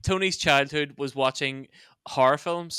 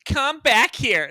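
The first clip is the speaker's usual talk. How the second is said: raised, drawn-out American call; American accent; 9 semitones higher, 5 dB louder, much slower than usual. Acted. Student starting a mock heated fight, American accent. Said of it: "We should put on American accents".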